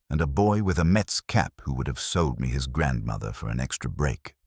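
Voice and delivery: ordinary talk, nothing marked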